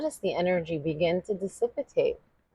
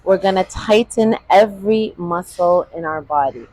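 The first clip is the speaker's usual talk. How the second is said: strained while tensing; 10 dB louder than usual. Real, not acted. Tensing body while holding breath.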